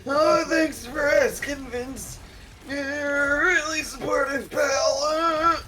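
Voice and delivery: sarcastic. Pretend. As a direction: ham up the fake enthusiasm. Mock enthusiastic reply.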